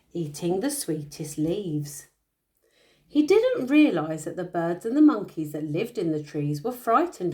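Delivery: bedtime storytelling voice; story narration. A narrator's reading. Parent reading a picture book to a child, storytelling. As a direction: read the tale aloud to a little one.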